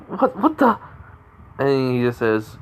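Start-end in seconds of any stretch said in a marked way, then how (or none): 0.1-0.8 high-pitched, stammered bafflement; retold startled disbelief. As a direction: act out her startled confusion, pitched way up. Retelling girl's startled reaction to rescuer.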